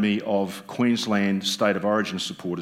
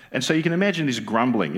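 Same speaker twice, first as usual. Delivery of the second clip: ordinary talk, nothing marked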